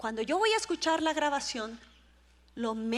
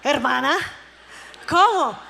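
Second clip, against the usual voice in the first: loud, incredulous outburst; astonished reaction; 8 dB louder than usual. Retold or imitated. Preacher reacting to a sister's confession, loud astonishment. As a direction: exclaim it loudly in disbelief. Acts her astonished reply.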